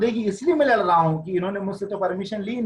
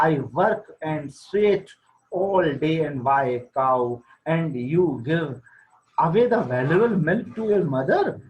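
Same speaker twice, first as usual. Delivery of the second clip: slow, deliberate reading of the English text; slow story reading; 3 semitones lower, much slower than usual. A narrator's reading. Teacher reading the wife's line slowly aloud. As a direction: read each word out slowly to the class.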